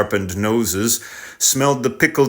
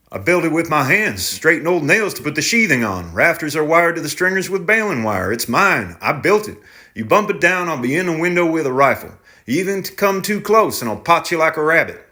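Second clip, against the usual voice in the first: tenant, defiant threat; 7 semitones higher than usual. Acted.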